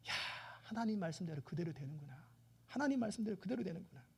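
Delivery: soft, awed murmur; hushed amazement. Acted. Israelites seeing God's word come true, in hushed wonder. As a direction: marvel quietly to yourself.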